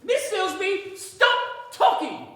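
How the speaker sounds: loud, slow, exasperated order; fed up and shouting her down; angry shout. Acted. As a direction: shut her up, you're fed up. Man silences a chattering woman.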